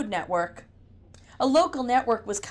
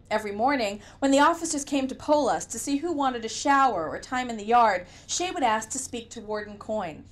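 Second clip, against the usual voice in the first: ordinary delivery, nothing marked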